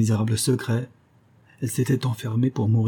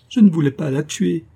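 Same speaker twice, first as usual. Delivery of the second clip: higher and louder, pleading her innocence; desperate cry; 6 semitones higher, 5 dB louder than usual. A narrator's reading. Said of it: "criait-elle". (cri désespéré)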